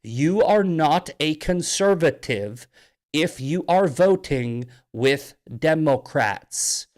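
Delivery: slow and deliberate, word by word; slowed-down, pointed repeat. Shown as a demonstration. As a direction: spell it out slowly for Massie. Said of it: "I'll say that as slow as possible".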